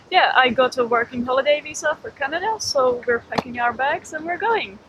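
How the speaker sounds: normal talking voice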